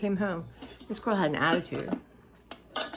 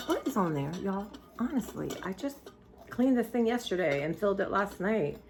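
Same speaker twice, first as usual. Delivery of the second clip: ordinary talk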